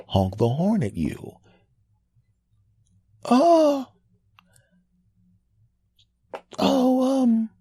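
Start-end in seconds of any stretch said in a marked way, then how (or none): 3.2-7.5 high deflated realization; a squeaky dawning oh; high sheepish oh. Retold or imitated. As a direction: driver realizing he was wrong, in a high squeak. Driver realizes his mistake.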